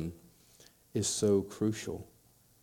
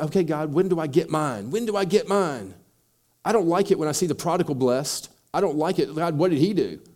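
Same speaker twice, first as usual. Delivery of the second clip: higher, louder complaint to God; aggrieved churchgoer; 6 semitones higher, 7 dB louder than usual. Retold or imitated. Upset believer demanding answers from God, loud and aggrieved. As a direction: whine loudly about fairness. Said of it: "They get upset".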